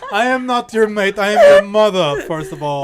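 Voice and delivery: loud, indignant British mother; British accent. Acted. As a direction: scold your child in a hammed-up British accent. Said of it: "We're British now".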